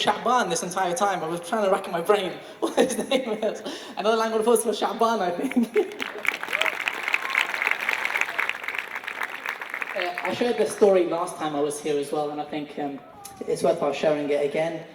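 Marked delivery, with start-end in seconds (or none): 6.1-9.9 long laugh that rises high; a real, high burst of laughter; high squealing laugh. Real, not acted. Laughs at own confusion.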